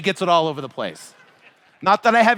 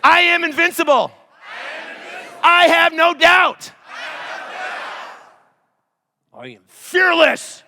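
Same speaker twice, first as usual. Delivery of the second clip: shouted affirmations; 10 semitones higher, 5 dB louder than usual. Shown as a demonstration. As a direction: declare each line like a battle cry. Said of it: "I say".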